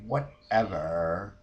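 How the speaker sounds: slow, drawn-out dismissal with a rise; dismissive brush-off. Retold or imitated. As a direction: stretch it out to brush her off. Dismissive end of work call.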